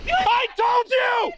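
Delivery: strained high yell; shouting. Real, not acted. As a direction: yell it, voice cracking. Guard yelling after shots.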